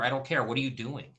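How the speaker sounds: ordinary talk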